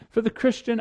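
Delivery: usual speaking voice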